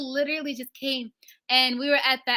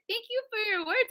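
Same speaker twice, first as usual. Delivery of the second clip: higher, softer, awed thanks; starstruck thanks; 9 semitones higher, 5 dB quieter than usual. Retold or imitated. Thanking [name] for her praise, soft high awe. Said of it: "I said".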